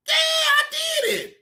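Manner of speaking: high, shouted mock confession. Mocking Kev Lowe's confession, high shout. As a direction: yell it like a gleeful admission.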